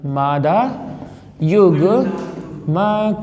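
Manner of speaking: very slow, long-held syllables; drawn-out dictation with pauses between parts. Shown as a demonstration. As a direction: stretch मादा युग्मक out piece by piece. Teacher dictates term piece by piece.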